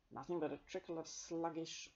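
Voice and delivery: ordinary delivery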